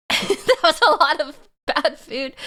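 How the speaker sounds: slow and laughing through the words; amused at her own bad meal, laughing. Real, not acted. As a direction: giggle it out slowly. Laughing about bad food.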